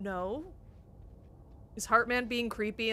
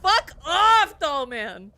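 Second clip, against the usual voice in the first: high, loud yell; shouting; 5 semitones higher, 11 dB louder, slower than usual. Real, not acted. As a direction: scream it at him. Yelling at creepy doll character.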